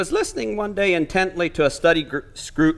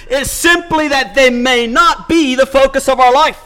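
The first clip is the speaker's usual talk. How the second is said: forceful preaching; 7 semitones higher, 5 dB louder than usual. Acted. Pastor urging dear friends away from law, fervent preaching. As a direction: lean into each phrase like a preacher. Preacher presses the point home.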